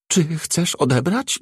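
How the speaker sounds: choking with tension. A narrator's reading. The house AI, told to sound hysterical, asking nervously. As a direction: ask as if the nerves are choking you. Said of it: "Głos aż krztusił się napięciem".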